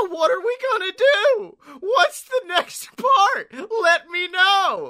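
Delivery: whiny mock plea. Mocking Abe's whiny plea.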